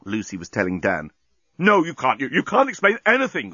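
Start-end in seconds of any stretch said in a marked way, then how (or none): 1.6-3.5 high, stammering protest; mild scream. A narrator's reading. Dan rejecting Lucy's explanation, mildly screamed. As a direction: cry out in a contained scream. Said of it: "screamed Dan".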